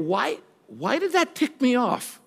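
high, soft, bewildered wondering; puzzled self-questioning. Acted. Person examining why they got ticked off, puzzled self-questioning. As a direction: ask yourself softly, pitch lifted in mock puzzlement. Voices someone questioning their own reaction.

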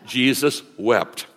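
"Jesus wept" said slowly and deliberately; slow, solemn "Jesus wept"; slow memory verse. Shown as a demonstration. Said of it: "here's our memory verse".

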